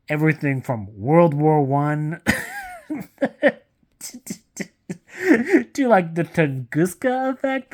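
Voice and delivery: breaks into high laughter mid-sentence; can't hold back the laugh. Real, not acted. Reviewer laughing at the villain's absurd backstory.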